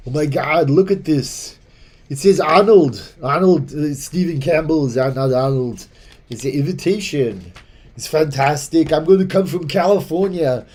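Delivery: hammed-up Austrian Arnold thrilled by a golden ticket; Arnold Schwarzenegger impression. Arnold reading his Circa invitation, Austrian accent building excitedly. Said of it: "So Arnold opens it up and he goes".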